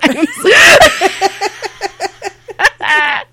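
long high-pitched cackle; big laugh. Real, not acted. Interviewer tickled by the Karen joke, long high laugh. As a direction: burst out laughing and let it run.

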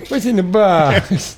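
high, slow, anguished movie cry; hammed-up anguished "what's in the box"; movie quote. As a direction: wail it like the desperate detective. Quoting the famous movie line.

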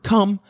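"come" called out high and bright; calling come. Shown as a demonstration. Trainer calling the dog with a bright "come". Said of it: "they're gonna hear the word".

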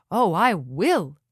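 slow and loud, beaming with delight. A narrator's reading. Fartissimus promising the old lady, slow and delighted. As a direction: draw out the promise, thrilled to help. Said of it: "said Fartissimus, delighted".

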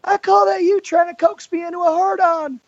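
very high, hammed-up Arn voice; Arn impression. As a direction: play the randy old wrestler. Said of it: "everybody has their own Arn impersonation".

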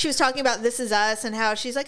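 normal talking voice

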